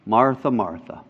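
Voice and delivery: soft, low and gentle words of correction; Jesus gently telling Martha of her worry, tender; tender rebuke. Retold or imitated. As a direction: correct her softly and kindly. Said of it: "kindness and tenderness ... the voice".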